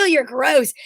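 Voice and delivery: normal talking voice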